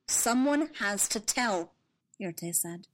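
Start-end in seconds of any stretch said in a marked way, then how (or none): 0.1-1.7 calm and grave. Acted.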